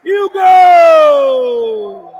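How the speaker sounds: roaring stretched goal call; explosive goal commentary. Real, not acted. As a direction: scream the goal, hold it long.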